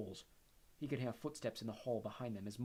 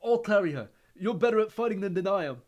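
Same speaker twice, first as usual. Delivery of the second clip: loud determined Neville; 9 semitones higher, 15 dB louder than usual. Acted. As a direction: insist loudly, then falter. Neville insists.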